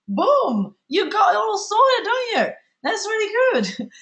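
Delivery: sarcastic high praise; playful teasing. Real, not acted. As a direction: tease them with fake admiration. Teasing viewers who didn't answer.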